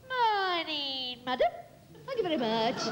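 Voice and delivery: a lightly voiced cheery tradesman; butcher impression. Hostess does her butcher, lightly voiced cheery tradesman. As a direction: greet her like a shopkeeper. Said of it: "it's my butcher, Mister Perkins".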